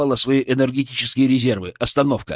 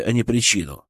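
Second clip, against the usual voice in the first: ordinary delivery, nothing marked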